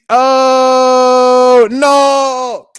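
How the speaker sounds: loud, high "oh no" of dismay; a startled outcry at what he just read; shocked cry. Real, not acted. Reading a fan's comment aloud, crying out in shock.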